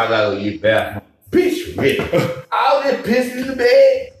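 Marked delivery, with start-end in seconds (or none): 0.0-1.0 fast, low mimic of the girl; a hint of the girl rushing off; quick mock girl voice. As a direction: rattle it off like her. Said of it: "she told me".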